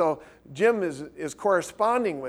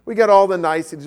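excited; 4 semitones higher, 12 dB louder, faster than usual. Real, not acted. Excited storytelling peak.

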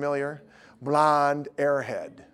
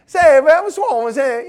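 high, loud slang greeting; high, loud imitation of a street voice; 10 semitones higher, 9 dB louder than usual. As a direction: mimic a street voice. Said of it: "I'm hearing this guy".

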